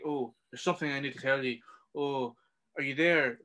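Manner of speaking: lightly mocking, dragging imitation; mocking the dawdler. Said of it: "Instead of being like".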